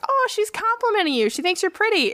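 high-pitched, pretend-touched reaction; mock flattered gushing. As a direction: gush as if naively flattered. Mocks a naive flattered reaction.

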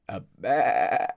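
a short bleating bah; goat bleat. Acted. GM voicing the goat's bleat at the players, goat bleat.

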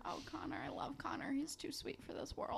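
soft, whispery affection; doting whisper. Real, not acted. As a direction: murmur it fondly, almost under the breath. Doting on a character.

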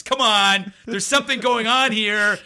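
a half-acted frustrated woman demanding honesty; exasperated outburst. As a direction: call the room out, fed up. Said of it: "she goes".

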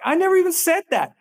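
high, fast, indignant imitation; mock protest. Accused sender protesting to the reader, high mock-indignant. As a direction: toss back the denial in a put-on voice.

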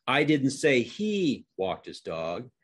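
he lifted and drawn out, slow sentence; single out the word he; stress on HE. Shown as a demonstration. Demonstrating how tone changes the sentence, stress on he. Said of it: "show you how this works just by using tone".

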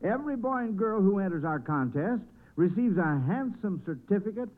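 usual speaking voice

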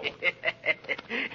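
high, soft gloating laugh; devilish laugh. Acted. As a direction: laugh like a tempter caught out.

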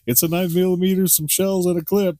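high, gushing gift-giver; mock excitement. Prankster handing over the birthday gift, mock excitement.

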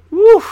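high, explosive whoo; loud whoop. Real, not acted. Storyteller blowing off steam, loud high whoop. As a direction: let out a sudden high whoop.

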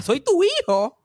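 high, loud confused echo; grandma repeating the words, puzzled, high. Retold or imitated. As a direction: echo it back, baffled and high. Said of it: "Y ella".

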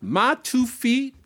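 high, theatrical, half-sung delivery; performed comic lyric. Acted. Performer playing an awkward dance pupil pleading with the teacher, high hammed-up singsong. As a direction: ham up the clumsy pupil begging the teacher.

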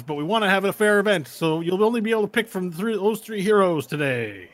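loud announcer voice stumbling over the event name; intercom announcer. Acted. GM voicing the announcer to the players, hammed-up tournament host. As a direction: boom it out like a tournament host on the speaker.

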